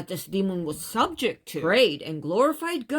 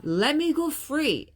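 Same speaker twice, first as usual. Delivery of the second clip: pleading; 7 semitones higher than usual. Retold or imitated. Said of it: "prayed and supplicated to the king".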